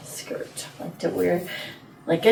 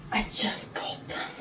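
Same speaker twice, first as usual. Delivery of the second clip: whispering; 12 dB quieter than usual. Real, not acted. Gasped whispered confession.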